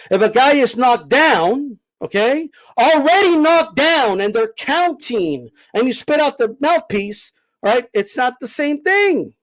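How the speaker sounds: slow, spelled-out patronizing explanation. Shown as a demonstration. Host explaining slowly to a guest who doesn't get it, patronizing slow pace. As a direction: drag each phrase out as if to a child. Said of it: "do I need to say it a little slower for you".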